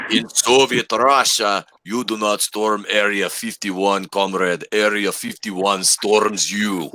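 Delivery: put-on slow, heavy Russian accent, drawn out; a hammed-up Russian comrade. Showing the group his Russian accent, slow and heavy. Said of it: "I'll do my Russian accent".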